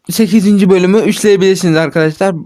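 usual speaking voice

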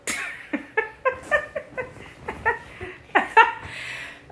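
a long, high, airless giggle; long breathy giggle. Real, not acted. Sister dissolving into giggles after the bit. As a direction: break up laughing at last. Gives up the act.

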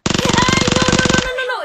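real panicked yell, high and loud; panicked scream. Real, not acted. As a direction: gritando en pánico porque la matan, muy fuerte y agudo. Dying in the game, panicking. (grito de pánico agudo y muy fuerte)

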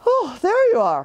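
high, light bear voice up in the sky; a cheerful little bear calling down; Pooh Bear. Acted. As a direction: Pooh calling down from his balloon.

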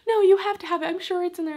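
high, insistent mock-tearful plea, loud at the start; crying mom. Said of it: "My mom's, like, crying. She's like".

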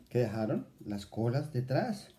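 ordinary delivery

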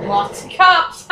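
loud, high cheer; excited. Real, not acted. Gamer yelling after shaking off the police, loud. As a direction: shout the win.

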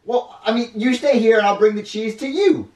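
high, loud character voice; reassuring kid character. Acted. Junior soothes his hungry rat, eager. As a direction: promise it in a high voice.

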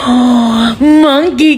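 sudden delighted call to her son; excited gasp. Real, not acted. Mom spots her toddler.

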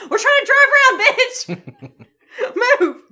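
high, loud shout through laughter; laughing mock yell. Couple yelling inside their car at people in the road. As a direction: yell at road-blockers as a joke. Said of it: "we yelled inside our car".

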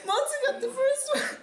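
high, squeaky words through giggles; helpless laughter. Real, not acted. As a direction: try to talk while cracking up. Cracking up at the spokesperson.

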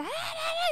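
very high imitated baby wail; mock baby cry. Baby crying with the other mother, very high mock wail. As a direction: squeal like a newborn.

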